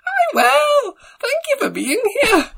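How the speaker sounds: very high, breathy-free squeaky Scottish TV host; Lorraine Kelly impression. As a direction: squeak it out like a chirpy breakfast presenter. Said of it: "It's Lorraine Kelly".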